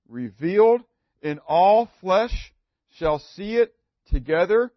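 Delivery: slow, proclaiming scripture reading; slow declaiming of the passage; solemn scripture reading. Acted. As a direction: read it out like a prophecy. Reads Isaiah scripture aloud.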